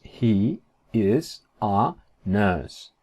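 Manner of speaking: very slow, one word at a time; drawn-out, careful English; slow model sentence. Shown as a demonstration. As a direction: leave gaps between every word. Teacher models English sentence slowly.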